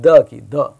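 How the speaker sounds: "द" said as a clear voiced d-like sound. Shown as a demonstration. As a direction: demonstrate "द", the voiced TH, loudly. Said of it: "इसकी sound कैसे आ रही है".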